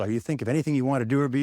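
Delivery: ordinary delivery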